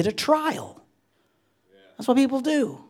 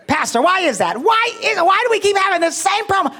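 high, loud mock-wailing complaint; exasperated woman; 13 semitones higher, 6 dB louder than usual. Woman complaining to her pastor about her husband, exasperated. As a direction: whine it high and loud, at wits' end.

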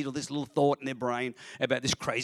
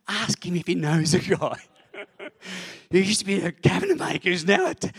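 loud, high, insistent pestering voice; nagging inner voice; 9 semitones higher, 10 dB louder than usual. Retold or imitated. Voice on the shoulder that won't stop, loudly nagging. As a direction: nag loudly, high-pitched and relentless. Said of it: "So finally this voice won't stop".

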